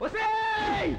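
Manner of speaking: sharp, loud and high yell; angry shout. Acted. Man yells at the woman to make her stop, a loud high shout. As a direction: bark her name to stop the beating. Angry call across the fight.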